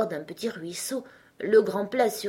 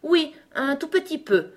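higher-pitched, mildly nervous reply; nervous king; 10 semitones higher, 4 dB louder than usual. A narrator's reading. King conceding a little to the unicorn, nervous. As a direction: concede it with a touch of nerves. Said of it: "répondit le roi d'un ton nerveux".

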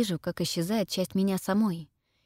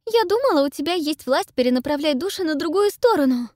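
high, loud, near panic; panicked exclaiming; 9 semitones higher, 6 dB louder than usual. A narrator's reading. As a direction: cry it out in rising panic. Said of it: "восклицает Пиппа ... панический страх".

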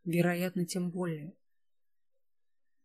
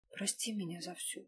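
much softer, barely voiced goodbye; quiet farewell; 11 dB quieter than usual. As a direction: breathe it out as a final plea. Last words before the bite.

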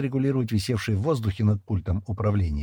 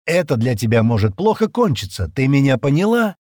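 loud, hard threat; cold warning; 5 semitones higher, 7 dB louder than usual. A narrator's reading. Стэн warning Джемма off his business, coldly threatening. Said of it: "холодно отрезал Стэн".

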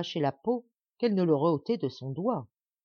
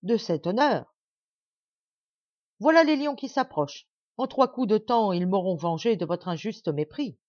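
angry threat; 3 semitones higher, 5 dB louder than usual. Acted. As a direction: threaten her with the lions. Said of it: "dit le nain en colère". (nain furieux qui menace)

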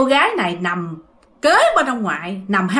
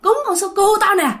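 grumpy grandpa yelling; 10 semitones higher than usual. Retold or imitated. Grandpa yelling at grandma about the offering, angry shout. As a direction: snap at the wife over the offering. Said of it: "ông ngoại la làng lên".

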